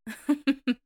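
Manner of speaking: a short, light laugh before answering; easy little laugh to brush off worry; light chuckle. A narrator's reading. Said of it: "with a light chuckle".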